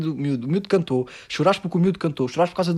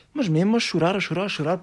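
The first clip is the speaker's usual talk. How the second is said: ordinary delivery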